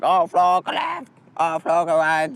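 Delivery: high, chirpy robot-ish guide voice; goggle voice. Pretend. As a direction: play nagging smart goggles. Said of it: "goggles, which always, um, say".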